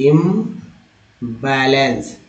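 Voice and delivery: इम्बैलेंस drawn out very slowly, syllable by syllable; very slow pronunciation. Shown as a demonstration. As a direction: spell out इम्बैलेंस slowly for learners. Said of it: "आईएम को इम बोलते हैं". (इम्बैलेंस बहुत धीरे-धीरे बोलें)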